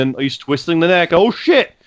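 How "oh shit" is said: high excited burst; excited exclamation. Retold or imitated. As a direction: shout it like a hyped fan. Imitating crowd reaction to move.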